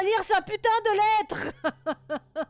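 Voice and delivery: excited. Real, not acted. Excited to read the letter.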